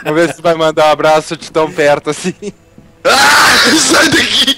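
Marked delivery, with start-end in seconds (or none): 3.0-4.6 shouting. Real, not acted. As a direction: yell it out while laughing. Caught in hide and seek.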